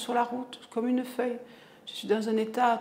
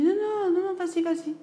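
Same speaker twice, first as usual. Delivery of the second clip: rising, loud insistent refusal; 8 semitones higher, 6 dB louder than usual. Retold or imitated. Mother loudly refusing her son's offer, insistent. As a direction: wave him off firmly, voice climbing.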